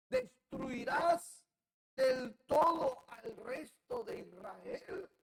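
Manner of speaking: high, slow anguished outcry; crying out loud. Acted. Ezekiel face down cries to the Lord, slow loud anguished outcry. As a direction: wail it up to God at full voice. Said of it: "y clamó a gran voz".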